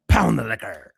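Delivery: "Pound the liquor" barked loud at normal pitch. Acted. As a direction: drop back down and shout it flat out. Chanting taunt at drinker.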